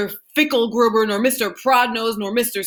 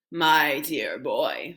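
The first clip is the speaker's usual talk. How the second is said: put-on lower, slow fond old man; lightly voiced grandfather, slow; old Grandpa Joe; 6 semitones lower, 4 dB quieter, much slower than usual. A narrator's reading. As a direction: lean in and savour the mystery.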